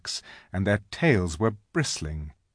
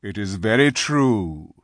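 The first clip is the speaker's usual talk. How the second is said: loud, measured lion voice; 5 dB louder than usual. A narrator's reading. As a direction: concede it solemnly as the lion. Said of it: "said Aslan".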